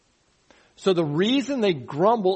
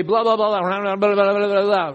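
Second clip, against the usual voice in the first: higher, louder blah blah blah whine; mock complaining; 4 semitones higher, 6 dB louder than usual. Preacher mocking people who gripe about officials, louder whine. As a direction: mimic a nagging complainer. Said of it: "you complain against your elected officials and you say".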